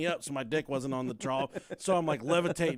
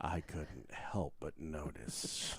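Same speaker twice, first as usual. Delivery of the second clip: very low, hushed and slow; mock confiding stranger; 11 semitones lower, 12 dB quieter, much slower than usual. [name] admitting he peeked at the urinal, mock confiding. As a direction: draw it out softly, deep and confidential.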